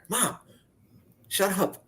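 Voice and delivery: joking high-pitched mock snap at mom; mock exasperated snap. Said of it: "I didn't say shut up".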